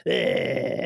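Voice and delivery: drawn-out "ehh" noise; a goofy, imitated tongue-out "eh". Acting out his pointing punchline, imitated tongue-out noise. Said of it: "sticks his tongue out and ... kinda goes".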